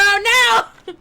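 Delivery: high, loud, laughing no; shouted refusal. Real, not acted. Killer yelling no at the hook carry. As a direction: yell it in protest.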